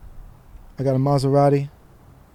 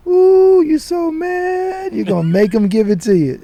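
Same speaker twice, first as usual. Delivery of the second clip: dad's teasing voice; 4 semitones higher than usual. Retold or imitated. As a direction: tease him like a knowing father. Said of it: "It's my dad's voice".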